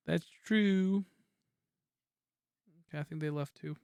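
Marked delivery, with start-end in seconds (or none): 0.1-1.0 louder. Real, not acted. Repeated louder, higher.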